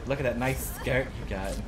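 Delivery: normal talking voice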